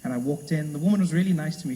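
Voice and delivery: ordinary delivery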